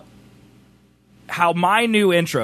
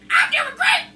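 high pet voice; slower than usual. Pretend. Voicing the pet snake.

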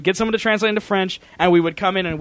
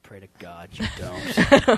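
very low, dragged-out warning; slow ominous mock threat; 9 semitones lower, 3 dB quieter, much slower than usual. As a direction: stretch each word out, deep and menacing in jest. Repeats mock threat, drawn out.

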